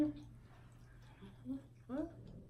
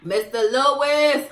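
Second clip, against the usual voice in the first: slow, louder teasing call; playful calling out; 9 semitones higher, 11 dB louder, slower than usual. Real, not acted.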